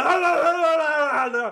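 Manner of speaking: loud, high incoherent howl; drunken gibberish yelling. Retold or imitated. Drunk passenger yelling out the window, incoherent hollering. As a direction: rave wordlessly like a rabid dog. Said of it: "because I'm drunk and I'm just like".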